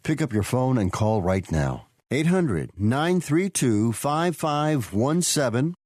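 hard sell ad. Acted. Ad voice urging listeners to call.

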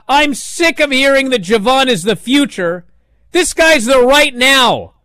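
loud, high, worked-up mock rant; big rant. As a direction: vent like a fed-up pundit. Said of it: "goes on this big rant".

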